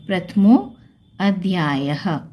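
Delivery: प्रथमो, then separate slow अध्यायः with "a" first and "ha" last; avagraha as a. Shown as a demonstration. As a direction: part प्रथमो from अध्यायः, drawing out the second. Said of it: "अ की आवाज़ आएगी". (प्रथमो, फिर धीरे "अ" से अध्यायः)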